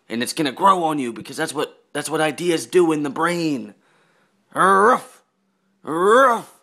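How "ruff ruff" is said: higher, louder yapping barks; playful loud dog impression. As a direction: bark right at her like a dog. Barking at sleeping girlfriend.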